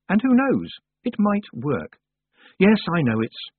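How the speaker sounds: high, hopeful village woman; flustered justifying. A narrator's reading. Mrs Carter excusing her request to Tiffany, high and flustered. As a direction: pitch it up, defending a small hope.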